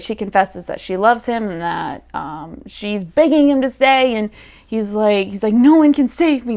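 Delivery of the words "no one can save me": higher, hammed-up brooding hero; mock melodrama, lightly done; melodramatic. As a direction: overplay the tragic line. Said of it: "being all melodramatic".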